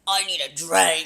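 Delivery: low put-on Australian accent; mock Aussie demand; Tynan. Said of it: "then you'd be like".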